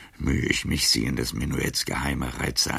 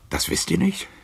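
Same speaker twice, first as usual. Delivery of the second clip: indignant high question; 3 dB louder than usual. Acted. Old Casanova's bitter tirade.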